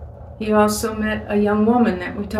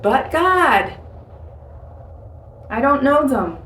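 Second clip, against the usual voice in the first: reluctant protest; 3 semitones higher than usual. Retold or imitated. Acts out own objection to God.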